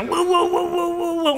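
high-pitched, alarmed whoas backing off; mock-startled leaders recoiling in a high voice. Religious leaders recoiling from John's preaching, high alarmed whoas. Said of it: "religious leaders and go".

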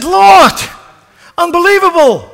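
very high, loud amazed exclamation; awed cry. Acted. Mary recognizing the risen Lord, crying out in awe. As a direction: cry out in wonder.

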